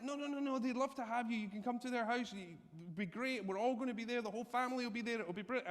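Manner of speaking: high, gushing insistence; insistent hostess. Retold or imitated.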